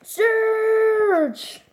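high, long held call; imitated battle cry. Player echoing a brawler's catchphrase, high drawn-out cry.

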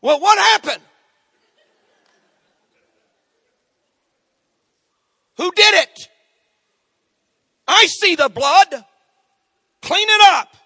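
loud, high, frantic dad yelling; dad hollering. Retold or imitated. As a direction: bark it like a panicked father. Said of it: "and dad's hollering".

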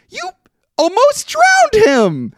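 high, loud, stretched-out disbelief; high outraged yelling. Real, not acted. Scolding a movie character to a friend, high and loud. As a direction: yell at the screen, appalled.

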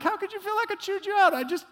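high, indignant disbelief; incredulous. Retold or imitated. Pastor protesting to church member who felt chewed out, high. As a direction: protest like you're wounded. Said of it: "I'm like".

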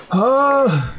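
lightly voiced older half-elf herder; a hint of a chatty lost old herder; goat herder voice.

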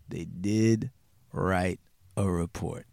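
slow, low, word by word; slow emphatic repeat. Real, not acted. Storyteller repeating the key fact about the report, slow and weighty. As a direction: repeat it slowly and low, letting each word land.

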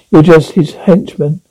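usual speaking voice

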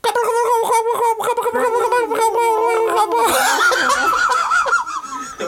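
loud, very high gobbling squawk; loud high turkey call. Pretend. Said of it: "Do your best turkey voice".

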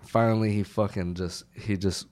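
ordinary talk

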